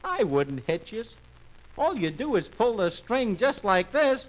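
put-on high little-boy voice reassuring; Chicken Nose. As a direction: explain in a small high voice. Chicken Nose answers the animals.

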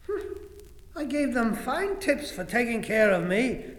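plain reading voice

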